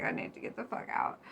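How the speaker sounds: imitating a desperate whisper. Card figure plotting his escape, half-whispered. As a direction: whisper it like you're trapped. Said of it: "he's just like".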